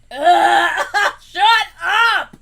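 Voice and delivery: loud laughing shut up; playful shout. Real, not acted. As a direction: yell it mid-laugh.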